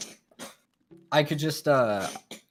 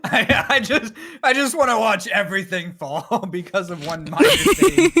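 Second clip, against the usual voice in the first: louder, higher, laughing as the words come out; 7 semitones higher, 6 dB louder than usual. Real, not acted. Gleeful about watching everything fall, laughing. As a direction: talk through real laughter, high and loud. Relishing society's collapse, laughing.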